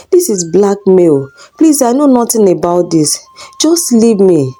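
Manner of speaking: plain reading voice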